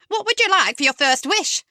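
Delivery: fluting fairy godmother. Acted.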